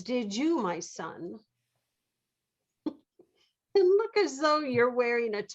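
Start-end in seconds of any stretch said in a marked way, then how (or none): 2.9-2.9 relieved laugh. Acted.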